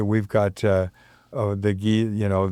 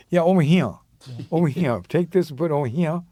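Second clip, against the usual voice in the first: "over here" run together as "omi hee-uh", pitched up; Mississippi accent; 6 semitones higher, faster than usual. Retold or imitated. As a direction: imitate a Mississippi local, dropping the r in "here". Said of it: "And he goes".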